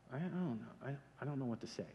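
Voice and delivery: softly mumbling, stuck for words; mumbled unsure reply. Retold or imitated. Disciples lost for an answer, mumbling softly. Disciples stumped by Jesus' question.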